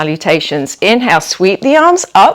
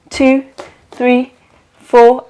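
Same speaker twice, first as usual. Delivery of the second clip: raised, evenly spaced count; inhale count; 4 semitones higher, slower than usual. Shown as a demonstration. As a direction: count the breath in on a lifted pitch, with gaps.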